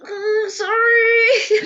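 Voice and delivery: hoog, traag, huilerig gespeeld; slow whiny fake-sob sorry; mock crying apology. Brother apologizes, voiced with crying.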